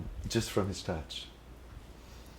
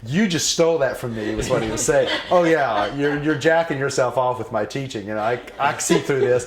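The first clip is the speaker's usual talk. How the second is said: higher, louder mock outrage with laughter; mocking teasing; 7 semitones higher, 8 dB louder than usual. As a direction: scold him, laughing. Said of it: "he goes".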